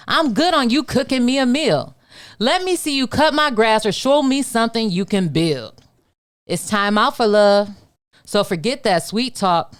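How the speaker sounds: spoken-word poetry performance; spoken word poem. Acted. Performs her poem IDGF.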